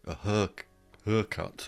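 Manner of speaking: haircut said northern English, like "hurr-cut"; northern English accent. Shown as a demonstration. Guest showing how the north of England says it, northern English accent. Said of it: "as they may say in the north of England".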